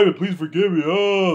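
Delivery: high mock wailing plea; crying imitation. Imitating step family begging Ivan's forgiveness, mock crying. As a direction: wail out a hammed-up apology. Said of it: "the step family is a cry".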